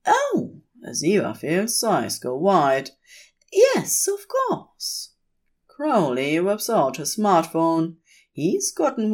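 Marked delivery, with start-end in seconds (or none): 0.1-0.6 high startled Oh; surprised angel. A narrator's reading. Aziraphale's eyes go wide. 3.5-5.1 much higher, delighted angel voice; Aziraphale. A narrator's reading.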